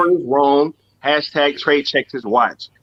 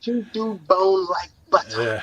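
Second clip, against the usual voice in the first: slow, higher mock British accent; 5 semitones higher, slower than usual. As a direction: draw out the film quote in a put-on British accent. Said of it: "perfect my British accent".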